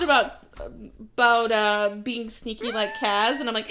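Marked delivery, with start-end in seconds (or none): none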